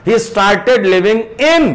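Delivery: in said long and strong at the end; slow teacher demo, in stressed. Shown as a demonstration. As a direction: push the final in.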